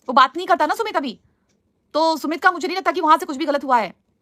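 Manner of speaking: real indignant sticking-up for a friend; heated defence. Real, not acted. Heatedly defends Sumit against viewer's comment.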